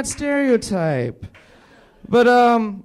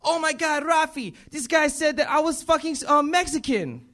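higher, put-on voice of an indignant mother; lightly voiced mom, outraged at the customer; mock upset mom; 7 semitones higher than usual. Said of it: "She was, like, pissed off".

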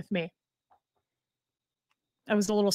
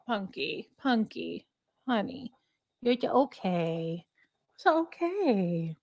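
very soft, slow hushed comfort; soothing the dog; 6 dB quieter, slower than usual. Real, not acted. Calling her dog Punky softly to calm her. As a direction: coax her gently in a near-whisper.

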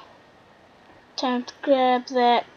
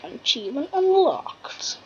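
whispered announcement; whispered achievement call. Pretend. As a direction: hush it like a secret.

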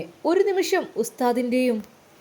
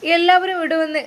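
repeated louder; 5 semitones higher, 4 dB louder than usual. A narrator's reading. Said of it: "വീണ്ടും അതാ പറയുന്നു".

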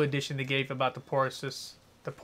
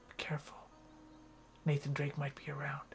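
whispering; 8 dB quieter than usual. Real, not acted. Sneaking, warning of enemy.

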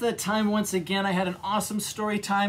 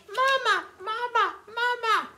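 put-on baby penguins calling.

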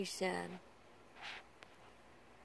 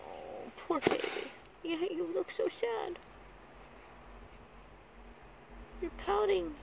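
put-on high, breathy baby-talk sympathy; hammed-up pity for a pouting baby; mock pity; 8 semitones higher than usual. Owner mocking her pouting pet with playful pity, high baby talk.

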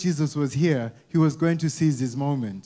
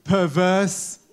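mock CV boast; slower than usual. Mock CV self-description.

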